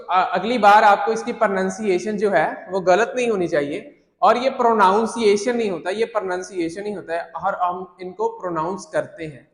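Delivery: ordinary talk, nothing marked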